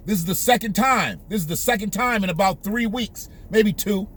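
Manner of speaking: ordinary delivery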